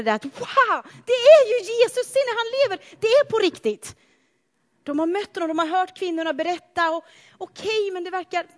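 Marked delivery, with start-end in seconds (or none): 0.2-4.0 much higher, breathless wonder; Peter's amazed joy. Retold or imitated. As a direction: burst out in astonished delight. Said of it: "det var så som Petrus reagerade".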